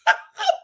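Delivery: high, loud laugh bursting out; burst of laughter. Real, not acted. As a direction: crack up at the outfit. Laughing at mom's outfit.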